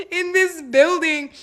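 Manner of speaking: high-pitched, breaking into real laughter; laughing while telling. Real, not acted. Confiding a courthouse mishap to viewers, laughing through it. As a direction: crack up as you get the words out. Cracking up over her own story.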